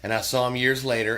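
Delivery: ordinary delivery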